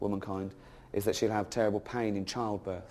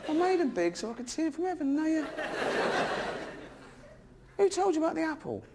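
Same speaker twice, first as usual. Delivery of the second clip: outraged God. Acted. Comic voicing God scolding Adam and Eve, high indignant squeal. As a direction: squeal it like a scandalised deity. God voiced in retold Genesis.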